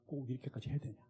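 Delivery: very soft, near-whispered; weary question. Retold or imitated. Pastor asking Mark on the climb, soft weary question. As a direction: ask it drained of strength. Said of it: "이렇게 물었어요".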